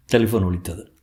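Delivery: usual speaking voice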